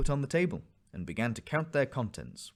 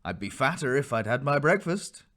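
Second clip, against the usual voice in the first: cheerful; 4 semitones higher, 7 dB louder than usual. A narrator's reading.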